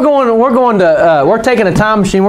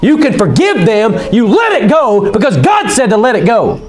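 high, insistent preacher exhortation; impassioned preaching; 6 semitones higher than usual. Real, not acted. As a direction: challenge the congregation in a raised pitch. Exhorts the congregation.

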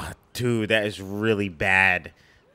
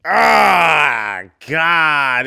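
a loud anguished outcry; pained loud groan; 5 semitones higher, 10 dB louder than usual. Real, not acted. Viewer dreading the movie scene, loud anguished cry. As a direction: cry out as if it hurts to watch. Reacting to a painful movie moment.

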